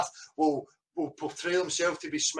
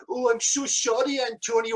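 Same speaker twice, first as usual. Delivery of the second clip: higher, sing-song fake apology; mock grovelling apology; 5 semitones higher than usual. Man mimicking a forced apology to his rival, mock-grovelling. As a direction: grovel in a mocking put-on voice. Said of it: "make you".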